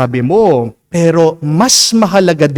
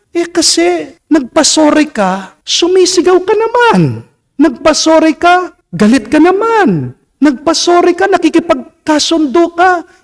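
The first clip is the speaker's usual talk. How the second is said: much higher, hammed-up irritated voice; mock shrill scold; 11 semitones higher than usual. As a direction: mimic a nagging, irritable spouse. Mimicking a shrill quarreler.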